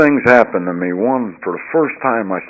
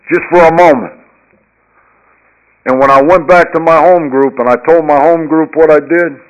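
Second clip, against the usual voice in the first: louder and higher, carried away by the testimony; preaching climax; 6 semitones higher, 8 dB louder than usual. Acted. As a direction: build it like a sermon peak, louder and higher.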